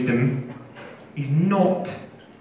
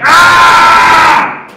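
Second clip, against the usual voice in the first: much higher, louder angry cry; enraged yell; 14 dB louder than usual. Retold or imitated. Interrogator roaring in frustration, enraged yell. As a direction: let out a raging yell. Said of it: "And he said to me".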